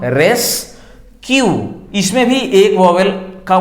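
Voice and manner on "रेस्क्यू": रेस्क्यू very slow, RES and KYU held apart; split syllables. Shown as a demonstration. Teacher pointing out each vowel, रेस्क्यू broken slowly in two. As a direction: sound out रेस्क्यू in two stretched beats. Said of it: "हम रेस बोल रहे हैं तो इसमें एक vowel".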